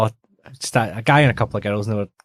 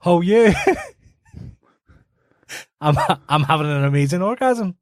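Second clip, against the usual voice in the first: laughing mock girl's taunt, higher; teasing girl; 7 semitones higher than usual. Giggling girl taunting a nervous man at his car, higher. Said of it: "one goes".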